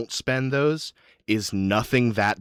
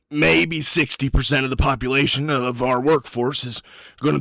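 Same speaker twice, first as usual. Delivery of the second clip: higher, louder, urgent voice; Guarn; 5 semitones higher, 5 dB louder than usual. Acted. Miner turns to you after the cave-in, urgent. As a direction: lay out the plan with pressing intensity.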